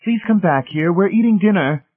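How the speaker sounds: high, loud, insistent call; urgent, penetrating. A narrator's reading. Marcia calling Miss Rice back to dinner, urgent and penetrating. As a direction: summon them back in a penetrating voice. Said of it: "called in an urgent, penetrating voice".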